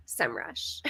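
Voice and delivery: "SEMrush" as one word, "sem-rush", dropped low and soft; official pronunciation. Shown as a demonstration. Host settling the viewer's question, "SEMrush" said low as one word. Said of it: "it is officially".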